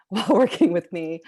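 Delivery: said through a burst of laughter; amused, laughing as she speaks. Real, not acted. Joking about colleague's patience with her.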